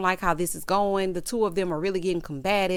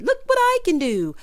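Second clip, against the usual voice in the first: high, loud sketch-comedy show-off; Stewie impression; 10 semitones higher, 6 dB louder than usual. Comparing him to a Mad TV act, mock show-off. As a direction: crow it high and loud, childlike. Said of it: "Okay, Stewie, it's giving Mad T V".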